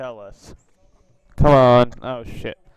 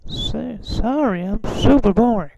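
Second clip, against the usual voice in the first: much higher put-on old man voice; Herbert voice; 9 semitones higher, slower than usual. Teasing strangers on video chat in high old man voice. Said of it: "a glimpse of my Herbert voice".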